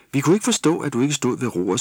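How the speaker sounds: high, loud wondering chorus; puzzled animals. A narrator's reading. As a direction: ask it like curious animals. (forundrede dyr)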